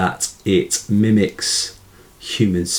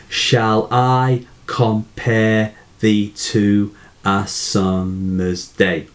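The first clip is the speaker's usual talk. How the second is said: slow, measured count of syllables; slow demonstration of ten syllables; slower than usual. Shown as a demonstration. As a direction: recite it slowly, giving every syllable its own space. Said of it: "these ten syllables".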